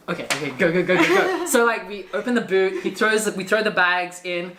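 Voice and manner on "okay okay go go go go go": excited mock urgency, pitch shooting up; urgent hurrying. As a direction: rush them along, half laughing. Acts out driver's sudden rush.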